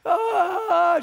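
loud, high mock bawl; imitated crying wail of a small girl; child's crying wail. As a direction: wail out like a child throwing a fit. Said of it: "she starts crying".

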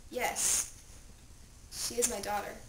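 hushed, understated grief; subdued father. Acted. Father identifying the body to the man in charge, subdued. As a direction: confirm it softly and low.

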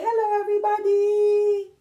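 high, loud Grover-style hello; happy greeting. Acted. Reading Grover greeting everybody to children, happy and loud. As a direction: sing out a cheerful hello, high and big. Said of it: "He's so happy. He said".

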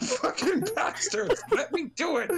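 high cursing through laughter; laughing yell. Real, not acted. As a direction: swear while cracking up. Laughing while cursing teammates.

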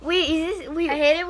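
loud, high shocked yell; shocked shout. Real, not acted. Brother realizing he was filmed, shouting in shock. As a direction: shout it in total disbelief. Reacting to hidden camera reveal.

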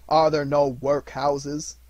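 loud, slow, biting echo; mocking ghost. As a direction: throw his words back at him. Spirit throws his own words back.

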